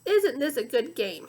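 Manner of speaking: ordinary delivery, nothing marked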